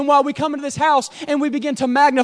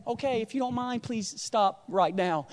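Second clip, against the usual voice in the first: hushed, over-polite mock plea; mock polite request; 4 semitones lower, 8 dB quieter than usual. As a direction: ask the storm nicely, as a joke. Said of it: "something so calm and something so nice".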